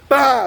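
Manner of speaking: loud, high "bah" for a smack; imitated slap noise. Storyteller acting out the slap, explosive noise. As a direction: burst it out like a slap landing.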